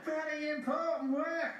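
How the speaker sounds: high-pitched eager cry; excited exclamation. A narrator's reading. James jumping at important work, exclaiming. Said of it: "exclaimed James".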